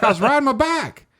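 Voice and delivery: loud, high mock East Tennessee twang; hammed-up Eastern Tennessee man insisting; Eastern Tennessee accent. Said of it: "bike were both pronounced back".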